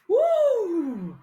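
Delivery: high, drawn-out "woo"; excited whoop. Real, not acted. Host cheering the abundance queen's masterclass, excited whoop. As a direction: let out a long high whoop.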